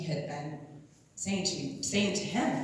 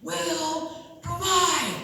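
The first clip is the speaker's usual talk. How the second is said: slow, drawn-out stranger's proclamation; booming voice; 9 semitones higher, much slower than usual. Retold or imitated. Stranger at the food bank proclaims it slowly and grandly. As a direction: stretch it out like a prophecy. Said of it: "this big booming voice".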